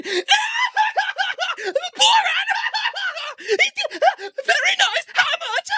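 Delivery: very high, loud cackling mimicry; Borat. Imitating fans who repeat it and love it, shrill mockery. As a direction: shriek the catchphrase through exaggerated fan laughter. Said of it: "they all fucking repeat it".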